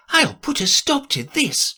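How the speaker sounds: put-on a curious girl thinking aloud; Alice to herself.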